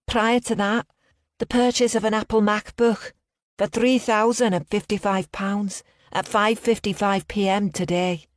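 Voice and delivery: high, put-on Liverpudlian accent. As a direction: fake a Liverpool bank caller. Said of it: "I put on a strong Liverpudlian accent".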